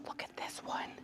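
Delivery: hushed whisper; breathy whisper, barely audible. Real, not acted. Quiet aside while browsing.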